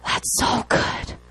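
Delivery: soft whispered awe, slow; awed whisper. Retold or imitated. Preacher recalling his reaction to God's word, awed whisper. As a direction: breathe it out in wonder. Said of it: "I was like".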